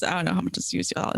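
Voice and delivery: ordinary talk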